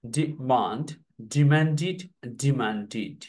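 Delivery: ডিমান্ডেড with the ending as did, not ded. Shown as a demonstration. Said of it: "এটা হবে ডিড". (ডিমান্ডেড শেষে ডিড উচ্চারণ)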